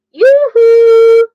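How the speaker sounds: high, loud held sing-song call. A narrator's reading. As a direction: sing it out across the house. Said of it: "they called".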